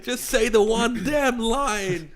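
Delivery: high, slow, drawn-out exasperation; exasperated plea. Real, not acted. Complaining to friend about the cashier, slow exasperated. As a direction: stretch it out in comic despair. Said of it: "complaining about the cashier".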